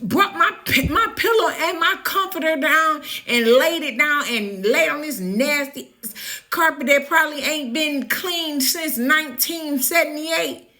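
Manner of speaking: high-pitched offended venting; outraged retelling. Retold or imitated. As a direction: rant it, half laughing at the absurdity. Retelling outraged reply, laughing.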